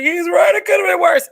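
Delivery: high, loud mock exclamation, half laughing; mock shouted agreement. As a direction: shout it like a sudden realization. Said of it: "And I was like".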